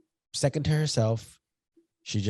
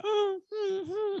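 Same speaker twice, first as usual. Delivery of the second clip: very high whimpering yelp; mimicking a hurt dog's cry; 4 dB quieter than usual. Imitating dog yelping in pain.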